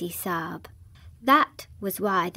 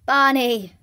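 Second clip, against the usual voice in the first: calling out louder to a comrade; cried out; 3 semitones higher, 6 dB louder than usual. Acted. Officer calling Barney on finding the child, cried out. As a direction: call his friend's name in alarm. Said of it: "he cried out".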